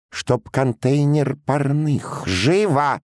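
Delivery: officer's slow, high-pitched order; high, almost feminine voice. A narrator's reading. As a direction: give the command in a womanish high voice. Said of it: "произнёс подпоручик высоким, почти женским голосом". (высокий, почти женский голос)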